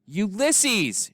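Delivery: high calling shout. A narrator's reading. Flora calling Ulysses in the woods, shouting. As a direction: call out into the darkness. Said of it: "shouted Flora".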